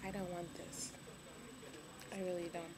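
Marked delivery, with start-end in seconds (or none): 0.1-0.9 whispered under her breath; whispering. Real, not acted. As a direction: whisper it to yourself. Whining about hospital Jell-O.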